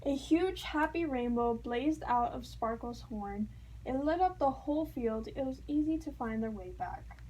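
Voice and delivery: ordinary delivery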